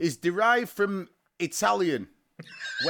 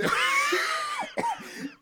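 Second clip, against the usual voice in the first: shrieking laugh. Real, not acted. Host shrieking with laughter at the noise. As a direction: lose it completely.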